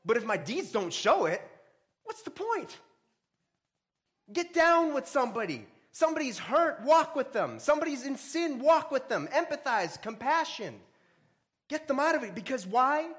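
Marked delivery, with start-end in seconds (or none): 2.1-2.8 sudden leap up high, tossed off; a high, incredulous rhetorical question; rhetorical challenge. Acted. 4.3-13.1 higher and louder, driving exhortation; full-force sermon exhortation; fiery preaching. Acted.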